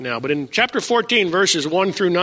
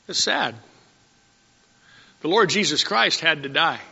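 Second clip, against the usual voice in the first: solemn sorrow. Acted. Solemn slow preaching on sacrifice.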